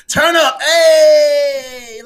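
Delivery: high, loud party shout; mock party hype. Mocking party podcast hosts with a loud hype shout.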